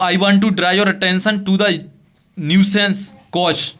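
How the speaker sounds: very slow, each word set apart; slow dictation. Shown as a demonstration. Teacher reading the letter line to students, very slow. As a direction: dictate it so they can copy it. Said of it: "teacher dictating letter slowly".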